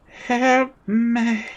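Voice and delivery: high, thin plea trailing into a sigh; feeble cry for help. Acted. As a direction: plead weakly from far away. Stranger far off calls feebly.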